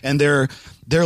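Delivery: ordinary talk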